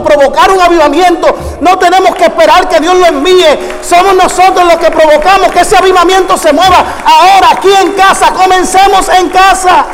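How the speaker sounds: high-pitched, forceful revival preaching; full-force preaching. Real, not acted. Preacher calling the church to start a revival, full-force preaching. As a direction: drive the chain up to a shouted climax.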